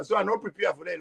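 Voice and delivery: usual speaking voice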